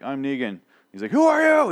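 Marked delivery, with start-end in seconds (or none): 1.1-1.7 high, strained mock shout; hammed-up yell, far above normal pitch; Simon's speech. Imitating Simon rallying the saviors, hammed-up high shout. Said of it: "That sort of speech".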